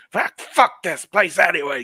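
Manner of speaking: slow mock angry outburst; imitating angry storm-off. Retelling Honky Tonk storming off, mock angry slow outburst. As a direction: spit it out like a wrestler quitting a show.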